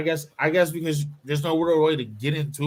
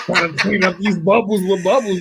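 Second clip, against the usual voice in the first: laughing; 6 semitones higher, 6 dB louder than usual. Real, not acted. Joking while friends laugh.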